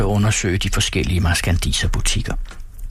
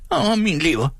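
high pained wail; old man's outcry; 10 semitones higher than usual. A narrator's reading. Old man gives in on the bargain, crying out. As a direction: whine it out high, falling away. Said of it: "udbrød den gamle".

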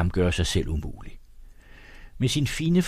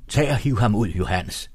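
plain reading voice

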